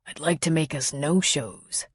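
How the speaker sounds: low gravelly murmur; rough and deep, gravelly. A narrator's reading. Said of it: "in a deep, gravelly voice".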